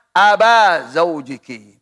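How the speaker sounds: "Aba Zaujiki" with an a ending on Aba; wrong case vowel. Shown as a demonstration. Teacher showing students a forbidden reading, "Aba" with long a. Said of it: "Tidak boleh baca".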